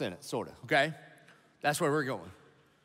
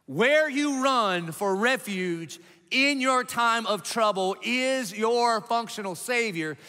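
1 slow emphatic repeat; 5 semitones higher, slower than usual. Acted. Repeated key line, slowed.

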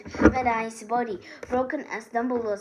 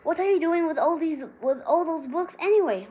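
annoyed; 6 semitones higher, 6 dB louder than usual. A narrator's reading. Ron after book bites ankle.

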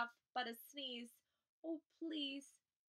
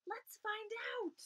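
usual speaking voice

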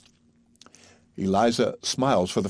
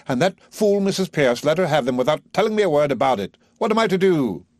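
high, loud, exasperated professor; agitated Higgins; 7 semitones higher, 4 dB louder than usual. A narrator's reading. Higgins complaining to his mother that Eliza bolted, agitated. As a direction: rattle off the grievance in a state.

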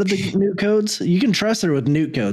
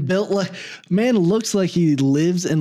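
ordinary talk

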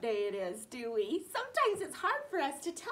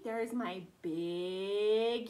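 "big U" low and slow; lower, slow voice for big letter; deep big voice; 3 semitones lower, much slower than usual. Shown as a demonstration. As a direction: drop low and drawl "big U". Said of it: "big versus little U".

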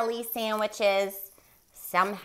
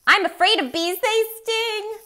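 high, loud panicked moose cry; a scared moose shrieking high and loud; frightened yell; 13 dB louder than usual. Acted. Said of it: "He yelled".

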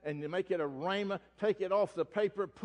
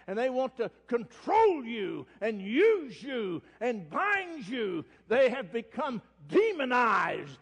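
louder, higher, slow denouncing preacher; fervent preaching; 5 semitones higher, 5 dB louder, slower than usual. Acted. Preacher denouncing the leaders to his congregation, fiery. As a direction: thunder it from the pulpit. Preaching rises to full force.

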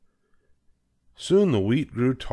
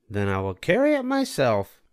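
higher hen voice, determined; Little Red Hen; 5 semitones higher than usual. A narrator's reading. Hen deciding to plant alone, higher determined voice. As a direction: resolve to do it alone. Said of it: "said the Little Red Hen".

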